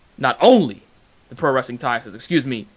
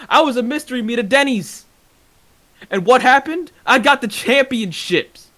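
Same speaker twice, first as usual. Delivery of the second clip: raised pitch, defiant retort; heated self-defence; 8 semitones higher than usual. Real, not acted. Wrestler defending his title history to a rival, heated. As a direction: fire back at the rival, pitched well up.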